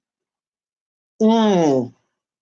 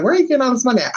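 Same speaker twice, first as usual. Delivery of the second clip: mom's demanding question; 7 semitones higher, much faster than usual. Retold or imitated. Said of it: "My mom was like".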